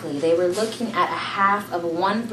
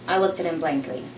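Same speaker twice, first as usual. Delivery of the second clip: plain reading voice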